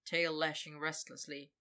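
ordinary delivery

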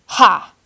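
loud scornful ha; one contemptuous burst; scornful bark of a laugh. A narrator's reading. Arthur retorts with a scornful ha at the boy. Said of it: "Arthur retorted".

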